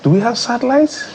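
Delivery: high-pitched incredulous question; doubting public. Retold or imitated. Host voicing sceptical Nigerians to his studio guest, high incredulous voice. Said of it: "Nigerians are like".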